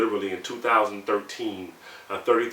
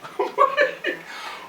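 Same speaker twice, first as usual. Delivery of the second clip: shocked breathy laugh, high; high unvoiced laugh; incredulous high laugh. Real, not acted. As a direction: laugh in disbelief.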